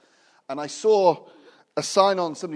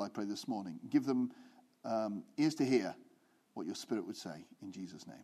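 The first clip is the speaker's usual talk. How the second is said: softer, lower, hushed praying voice; earnest prayer; 3 semitones lower, 5 dB quieter than usual. Acted. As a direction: pray quietly and reverently over the people. Leads the congregation in prayer.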